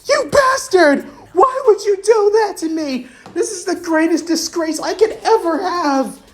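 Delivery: very high, loud shrieking fury; screaming high-voiced rage. Rainer screaming at Noctis, high hysterical fury. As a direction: shriek it out, half laughing, half crying. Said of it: "blood-curdling scream".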